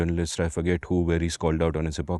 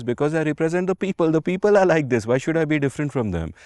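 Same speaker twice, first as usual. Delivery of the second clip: mock defiant retort; 7 semitones higher than usual. Havel answering a press reporter, raised and defiant. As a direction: throw the answer back, head high.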